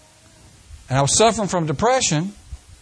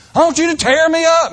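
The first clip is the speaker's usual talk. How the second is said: mock plea; 7 semitones higher, 4 dB louder than usual. We quote our demand to God.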